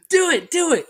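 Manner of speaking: high-pitched imitation of insistent pals; mock egging on. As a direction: egg someone on in a high mock voice. Imitating friends egging him on.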